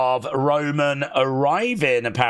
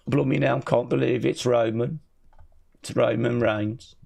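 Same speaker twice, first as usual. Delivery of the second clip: put-on Birmingham accent. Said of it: "That's the Birmingham accent".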